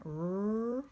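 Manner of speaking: "R-E-D" as separate sounds; sounding out. Shown as a demonstration. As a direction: sound out "R-E-D" slowly. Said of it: "Which word is that?".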